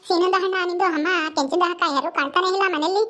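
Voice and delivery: usual speaking voice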